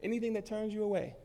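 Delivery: ordinary talk, nothing marked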